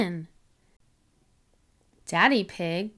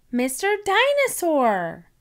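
excited cry; 7 semitones higher than usual. A narrator's reading. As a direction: cry it out with joy. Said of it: "she cried".

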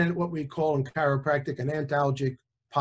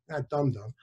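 normal talking voice

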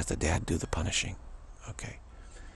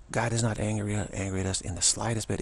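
ordinary talk, nothing marked

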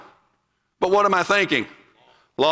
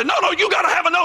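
quick, higher, pushy correction; a hammed-up hasty objection; mock pushy rebuttal; 6 semitones higher, faster than usual. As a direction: rattle off a hasty no like the wrong approach. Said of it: "I'm not going to look negative".

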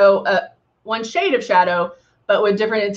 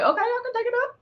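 quoted self-talk; 11 semitones higher, 7 dB quieter, much faster than usual. Retold or imitated. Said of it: "But you might be like".

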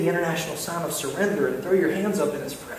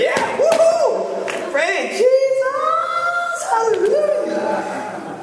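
high, drawn-out whoop; exuberant worshiper; much slower than usual. Acted. Eager worshiper cheering in the congregation, exuberant. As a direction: holler praise with long, high vowels. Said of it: "worshipers like me. You hear that and you're like".

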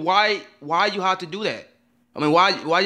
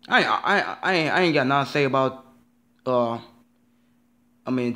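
normal talking voice

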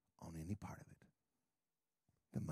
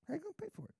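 mock worried voice; 8 dB quieter, much faster than usual. Repeated question, mock voice.

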